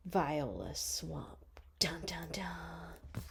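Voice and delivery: slow, hushed whisper for a villain's name; spooky whisper. Acted. Reader teasing the villain's name to listeners, slow whisper. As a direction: breathe it out like a dark secret.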